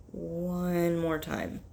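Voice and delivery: slowed right down; slow and deliberate. Real, not acted. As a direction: draw it out slowly. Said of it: "I'm gonna try it one more time".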